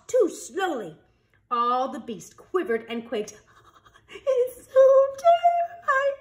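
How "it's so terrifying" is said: mock terror. Beasts quivering in fear.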